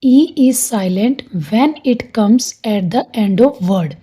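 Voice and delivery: slow, clear rule dictation; teacher dictating slowly; slow dictated rule. Shown as a demonstration. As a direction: read the rule slowly for notes. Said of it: "इसका rule है".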